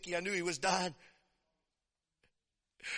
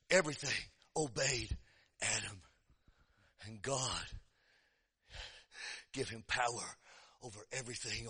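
much softer, lower sermon hush; hushed preaching; 11 semitones lower, 13 dB quieter than usual. Acted. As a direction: draw the congregation in with a hush.

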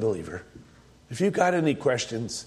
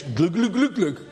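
high-pitched comic gobbledygook; mock Turkish; 5 semitones higher than usual. Preacher spoofing the name in Turkish for the congregation, mock Turkish. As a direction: bounce through nonsense syllables as pretend foreign words.

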